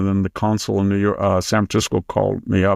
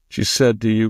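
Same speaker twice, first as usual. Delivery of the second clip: ordinary delivery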